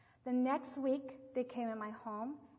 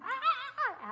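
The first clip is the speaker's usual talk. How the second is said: imitated baby wail; 6 dB louder, slower than usual. Baby's cry imitated.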